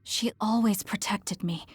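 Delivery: ordinary talk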